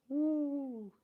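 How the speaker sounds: high, soft drawn-out woo; long, high-pitched woo; playful woo. Pretend. Playing with a sound feature.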